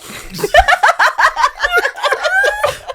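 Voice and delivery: high burst of laughter; loud laugh. Real, not acted. Laughing at odd word.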